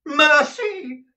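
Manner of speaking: a high, loud cry for mercy; terrified cry. Acted. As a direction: cry out in terror, begging. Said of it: "he cried".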